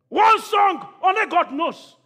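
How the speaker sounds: slow, high, shouted repeat. Acted. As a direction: shout it slowly at the crowd. Repeated line, shouted take.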